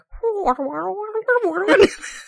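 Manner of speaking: high burbling underwater garble. Retold or imitated. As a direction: imitate muffled underwater audio. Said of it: "our audio sounds like we're underwater".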